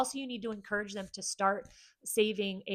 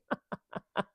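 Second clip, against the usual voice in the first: light laugh. Real, not acted. Laughing at husband's torture.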